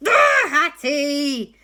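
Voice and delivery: loud, slow cry of alarm. Acted. Everyone crying out to Hattie as ghosts appear, loud. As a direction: shout her name in fright.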